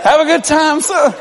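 high, happy farewell; dad cheerfully sends son to Dallas. Retold or imitated. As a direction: wave the son off warmly. Dad's send-off.

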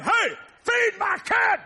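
high, slow shouted demand; angry yell. Retold or imitated. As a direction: yell at the nurses to feed her. Yelling at nurses.

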